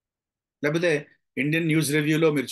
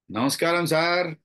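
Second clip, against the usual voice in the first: sarcastic. Shown as a demonstration. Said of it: "అంటే వెటకారం". (వెటకారంగా)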